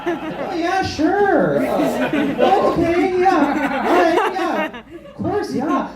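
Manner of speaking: high, hesitant Midwestern agreeableness; unconfident Midwestern. Shown as a demonstration. Comic shows audience the Midwestern accent, high and unconfident. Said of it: "say anything with less confidence".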